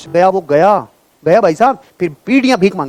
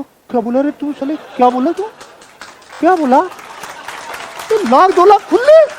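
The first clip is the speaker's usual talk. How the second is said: rising higher and higher in mock shock, slowing on the last line; stunned disbelief; 8 semitones higher than usual. Client reacting to being asked for lakhs, shocked and incredulous. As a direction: play the client who can't believe the amount.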